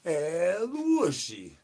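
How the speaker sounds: very slow and high, each syllable stretched long; drawn-out El Wushy call. Pretend. Streamer performing the El Wushy call chat asked for, slow and high. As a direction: croon the name out slowly like a summons.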